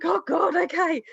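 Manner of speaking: high, sighing mum giving in; a put-upon mum caving; weary mum. Retold or imitated. Mum's weary reply.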